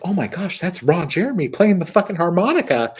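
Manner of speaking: high-pitched mock starstruck exclamation; amazed onlookers. Storyteller imitates fancy diners spotting Ron at the lounge, amazed. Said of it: "fancy people are like".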